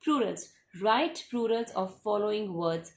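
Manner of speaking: reading the next question to pupils, very slowly. Shown as a demonstration. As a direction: read the instruction out very slowly. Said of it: "teacher reads the question slowly".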